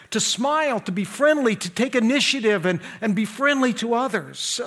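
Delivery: high, energetic pulpit exhortation; forceful preaching. Real, not acted. Preacher enrolling a burdened member as greeter, forceful exhortation. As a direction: drive each item home to the congregation.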